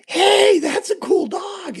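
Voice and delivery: put-on high, loud kid's shout; excited kid. Trick-or-treater shouting at the chef about his dog, high and loud. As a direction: blurt it out like an eager little boy. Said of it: "this kid's like".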